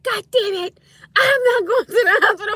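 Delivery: high, laughing mock outburst; spazzing mom. Teller quotes mom refusing the hospital, spazzing through laughter. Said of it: "My mom started spazzing".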